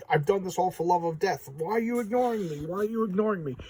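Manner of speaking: high, wailing mock plea; imitated pleading, pitch climbing; begging for attention. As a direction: whine it like a spurned suitor. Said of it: "begging her for attention".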